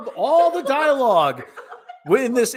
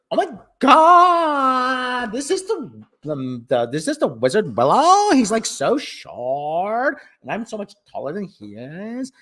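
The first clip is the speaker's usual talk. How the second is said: put-on Valley Girl accent. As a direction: stretch key words like a Valley Girl. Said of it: "have a Valley Girl accent".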